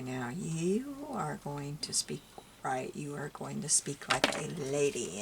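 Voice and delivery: slow, careful, fully enunciated correction; strict grandma. Retold or imitated. Grandma drilling her grandchild in proper speech, strict. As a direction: imitate a grandma stickler measuring out each word. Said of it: "she was a stickler for punctuation and speech".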